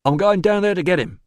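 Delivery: high, loud, determined man's voice; Stickus. Acted. Newcomer promising to fetch the boy, determined. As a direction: announce the plan boldly.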